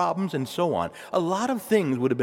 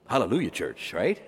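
usual speaking voice

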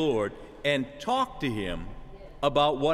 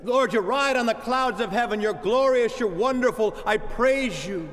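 higher, fervent praise prayer; 4 semitones higher than usual. Shown as a demonstration. Preacher demonstrates praise-filled prayer to the congregation, fervently. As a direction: lift up praise to God with full fervour. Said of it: "start like this".

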